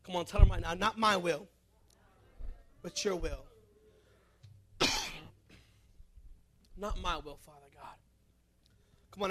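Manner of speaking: low, hushed prayer-leading voice. Acted. Pastor leads the standing congregation in prayer, hushed and low. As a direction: guide them gently into prayer.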